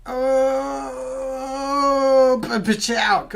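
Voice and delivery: high, loud cry of the catchphrase, fumbled; Lightning McQueen impression. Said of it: "worst, uh, Lightning McQueen impersonation ever".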